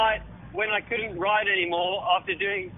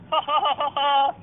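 mock gloating laugh; 5 semitones higher, 4 dB louder than usual. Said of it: "posted on his bloody Instagram going".

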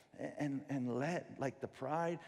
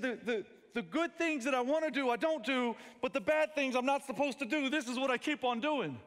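put-on higher, louder anguished little-boy voice; child's confession; 10 semitones higher, 7 dB louder than usual. Son confessing to daddy by the fireplace, high and loud. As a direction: blurt it out like a frustrated kid. Son quoting Romans to father.

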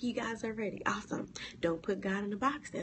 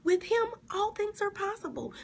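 exclaimed verse; 12 semitones higher than usual. Shown as a demonstration. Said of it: "restated loudly against whisper".

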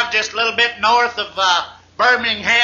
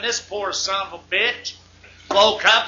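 usual speaking voice

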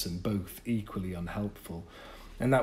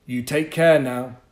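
kind farewell; 4 semitones higher, 11 dB louder than usual. Acted. Bennett says goodbye.

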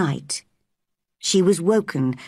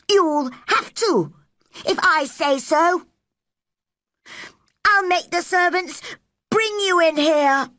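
put-on high-pitched bossy child; imperious boy; 11 semitones higher than usual. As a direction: order her about in a high voice. Colin orders Mary in quarrel.

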